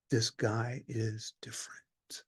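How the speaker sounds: slow awed whisper. Real, not acted. The centurion confides to the congregation: slow whisper. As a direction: breathe it like a secret.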